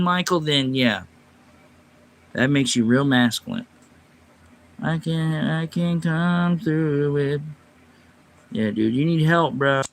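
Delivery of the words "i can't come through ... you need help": usual speaking voice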